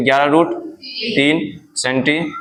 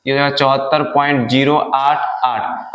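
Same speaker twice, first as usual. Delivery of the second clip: ordinary delivery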